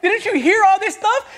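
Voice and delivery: high, loud indignant questions; exasperated protest. Retold or imitated. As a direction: push back at the pastor in disbelief. Retold protest to the pastor.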